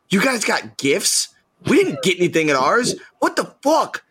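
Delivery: put-on boys protesting missing gifts; mock outrage. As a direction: kids whining they got nothing, rising in outrage. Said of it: "we were so angry".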